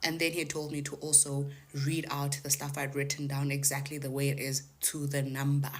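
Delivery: ordinary delivery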